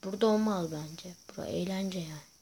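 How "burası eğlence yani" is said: whisper. Real, not acted. Aside while driving in game.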